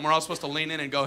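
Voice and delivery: normal talking voice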